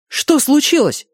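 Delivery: high, urgent shout; yelled question. A narrator's reading. Boy demanding news from the cook, yelling. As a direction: cry it out in alarm. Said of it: "заорал я".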